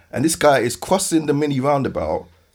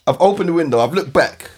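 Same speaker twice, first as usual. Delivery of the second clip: ordinary talk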